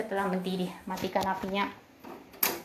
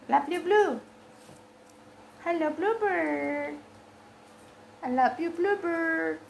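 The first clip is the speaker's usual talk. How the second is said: drawn-out pet-talk, pitched up; affectionate cooing; 4 semitones higher than usual. Real, not acted. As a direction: coo lovingly to a pet bird. (sapaan sayang mendayu untuk burung)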